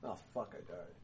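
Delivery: low, soft groan of dismay; dismayed ghost. Acted. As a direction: sink low and quiet as it dawns on him. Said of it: "he turns around and he goes".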